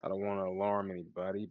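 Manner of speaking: normal talking voice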